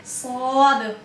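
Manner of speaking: सौद said thick, with a full rounded vowel; teacher demonstrating a heavy letter; heavy s demo. Shown as a demonstration. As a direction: fill your mouth on सौद. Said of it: "मोटा किस तरह करेंगे".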